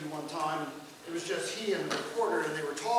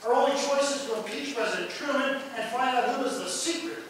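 reading a fiery denunciation; 5 semitones higher, 8 dB louder than usual. Acted. Lecturer quoting a senator's attack on Truman, mildly hammed up. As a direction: read it like a senator's tirade.

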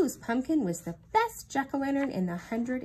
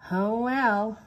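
moaning; 6 dB louder than usual. A narrator's reading. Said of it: "moaned Eeyore".

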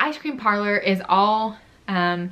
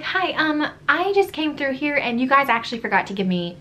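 high-pitched, lightly snippy customer; politely pitched complaint with a touch of snippiness; nice but snippy; 5 semitones higher than usual. Retold or imitated. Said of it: "kind of nice at first, but still, like, kind of snippy".

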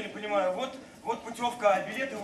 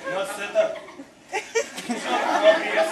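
laughing; 4 semitones higher, 5 dB louder than usual. Acted. Laughing reply.

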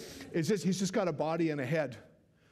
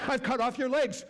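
laughing; 11 semitones higher, 4 dB louder than usual. Real, not acted. Laughing while quoting Arthur.

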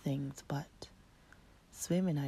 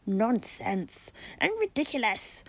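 pompous cockerel; 5 semitones higher, 5 dB louder than usual. Acted.

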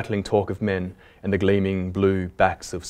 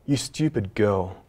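reproachful; slower than usual. A narrator's reading. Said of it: "Queen reproached her".

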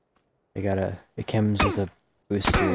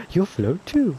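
creepy clown voice; 10 semitones higher than usual. Pretend. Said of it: "You sound like you're one".

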